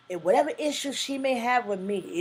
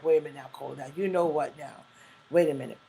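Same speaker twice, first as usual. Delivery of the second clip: lower, mock-suspicious "wait a minute"; doubting adult self; 6 semitones lower, much faster than usual. Imitating a parent doubting her child, lower and skeptical. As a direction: act out your grown-up self doubting the child. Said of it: "we may be like".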